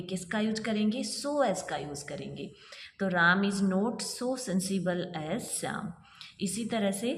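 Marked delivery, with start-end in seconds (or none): 3.1-5.9 every word spaced out slowly; slow dictation. Shown as a demonstration. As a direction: read it slowly so they can write. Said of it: "not आते ही हमें पता लग गया". (धीरे-धीरे लिखवाना)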